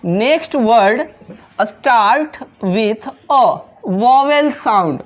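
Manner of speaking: each word drawn out slowly for the class; slow classroom dictation of an English rule. Shown as a demonstration. Teacher slowly reads rule aloud.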